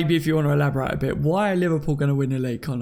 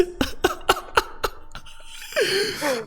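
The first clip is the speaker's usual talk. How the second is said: laughing hard; 4 dB quieter than usual. Real, not acted. Big laugh at Jamaican accent joke.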